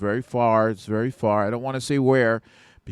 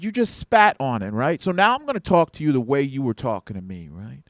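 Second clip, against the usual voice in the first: firm, raised; 5 semitones higher, 7 dB louder than usual. Retold or imitated. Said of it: "I'm being firm now".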